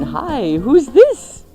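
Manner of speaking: ordinary delivery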